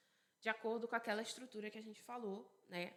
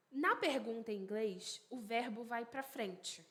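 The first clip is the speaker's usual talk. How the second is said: ordinary delivery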